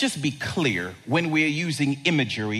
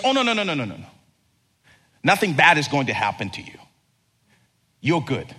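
reassuring angel; faster than usual. Acted. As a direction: settle them down gently.

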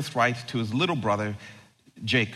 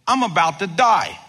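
rash outburst; 5 semitones higher, 5 dB louder than usual. Acted. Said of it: "he rashly said".

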